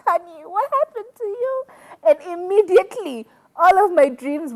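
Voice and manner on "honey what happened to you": mock shock. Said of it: "I'm like".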